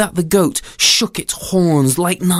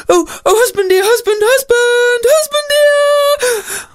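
hysterical mother; 4 dB louder than usual. Acted. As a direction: wail for your husband in panic. Mother runs screaming to husband.

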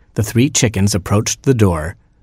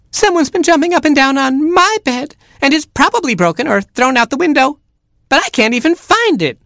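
put-on very high wailing baby chick; crying chick. Baby chick wailing about his missing bed. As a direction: wail like a tiny chick. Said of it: "cried Baby Chick".

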